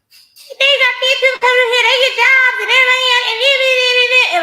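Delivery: mock them in a shrill singsong; mocking high voice. Mocking voice mid-rant.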